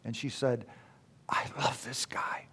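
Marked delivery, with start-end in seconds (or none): 1.3-2.5 retold hushed, moved whisper; tearful whisper. Imitating tearful Carrie confiding in him after the poem, whispered. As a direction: whisper it softly, as if overcome. Retelling his tearful companion's words.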